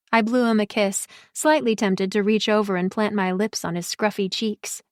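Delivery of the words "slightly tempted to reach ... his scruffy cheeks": usual speaking voice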